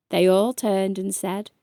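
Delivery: ordinary delivery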